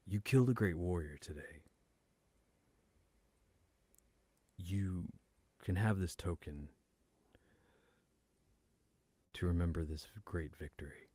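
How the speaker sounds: understated solemn tribute, long pauses. Acted. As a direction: hand over an honor gravely, pausing between phrases. Warrior presents a victory token.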